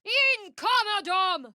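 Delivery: high, slow, drawn-out spell cry; spell incantation. Acted. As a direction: stretch the blessing out. Casting a spell in combat.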